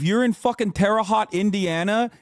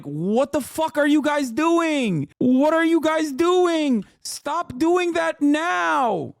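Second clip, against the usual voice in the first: higher, slow, exasperated pleading; exasperated outburst; 9 semitones higher, slower than usual. Retold or imitated. Imagined official to the TikTokers, slow and exasperated. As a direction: drag it out in horrified disbelief. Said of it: "I'm like".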